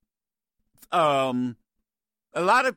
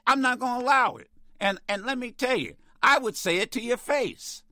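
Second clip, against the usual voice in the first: indignant; 7 semitones higher than usual. Real, not acted. Host tells off a caller.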